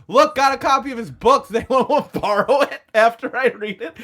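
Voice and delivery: high, loud fake enthusiasm; mocking offer called out to the room. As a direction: make fun of it with showy excitement. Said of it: "Just mocking him".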